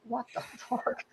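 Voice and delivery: breathless whispered laugh; whispered disbelief. Retold or imitated. Class reacting to the spy student, whispered disbelief. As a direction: gasp it out half-laughing.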